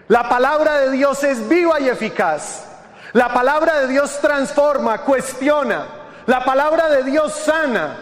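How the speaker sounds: high, insistent sermon cadence; forceful preaching. Acted. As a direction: drive home each repeated declaration.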